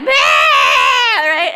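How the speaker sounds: loud mock bawl; imitating crying kids; kids' wail. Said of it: "they're still like".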